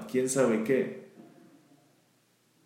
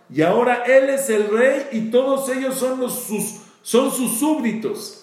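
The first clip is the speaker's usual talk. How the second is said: much higher and louder, swelling with fervor; impassioned climax; 10 semitones higher, 5 dB louder than usual. Real, not acted. Preacher to the congregation, ringing out in passion. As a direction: build it to a ringing peak.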